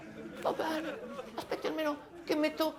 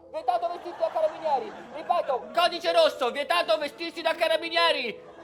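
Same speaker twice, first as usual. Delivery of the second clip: higher, loud dispatcher broadcast; police radio; 3 semitones higher, 5 dB louder than usual. Acted. Radio call to all patrol cars.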